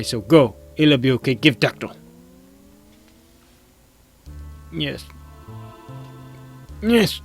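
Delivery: high, loud broken-English caveman. Acted. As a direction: bellow it like a dopey cave elder. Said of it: "I can't do the caveman voice".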